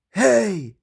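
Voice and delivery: startled hey. Acted. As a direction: call out in surprise. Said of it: "said Mike".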